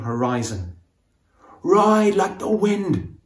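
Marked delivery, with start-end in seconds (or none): 1.6-3.2 higher, louder and slow on "Ride like the wind"; slow urging cry of "Ride like the wind"; shouted. A narrator's reading. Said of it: "shouts Phoenix".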